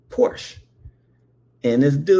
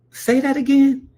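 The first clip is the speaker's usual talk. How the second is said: put-on much higher, louder kid voice; squeaky kid asking; 12 semitones higher, 4 dB louder than usual. Boy asking Timmy to repeat the word, high eager squeak. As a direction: ask again, high and eager. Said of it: "I said".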